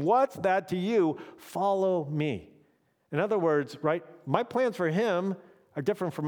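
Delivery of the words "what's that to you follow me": slow, deliberate and raised; gently firm command; emphatic Jesus line. Retold or imitated. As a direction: lean on each word of the rebuke. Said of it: "I love that Jesus said to him".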